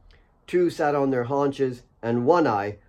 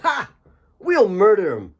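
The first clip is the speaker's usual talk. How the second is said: put-on much higher, bouncy teasing voice of a cheeky young miner; bubbly cousin; 9 semitones higher, 4 dB louder than usual. Cousin Asaf ribbing Alex about being too clean, high bubbly teasing. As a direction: bubble over with cheeky delight at seeing him.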